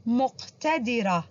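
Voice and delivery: مقتدرة slow, with a firm qaf, muq-tadirah; syllable-by-syllable مقتدرة, qaf clearly articulated; stress the qaf. Shown as a demonstration. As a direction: model the letter ق in مقتدرة. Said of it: "حرف القاف".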